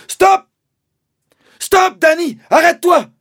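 very loud high warning; father warning his son of the pit, shouting; urgent shout. Acted. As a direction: stop him dead with your voice. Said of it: "a-t-il crié".

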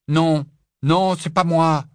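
loud, high frantic denial; desperate denial. A narrator's reading. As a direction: protest as hard as you can. Rogue denies desperately. (Rogue nie désespérément, très fort)

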